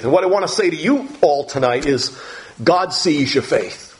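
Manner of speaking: ordinary delivery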